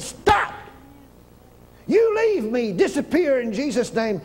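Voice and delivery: preacher rebukes cancer in a shouted command. Retold or imitated. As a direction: command the sickness to leave. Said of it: "you have to talk just like that".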